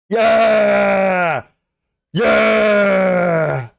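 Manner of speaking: big high celebratory shout; frat bro yell. Real, not acted. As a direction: roar it out like a party bro. Celebratory yells at stream.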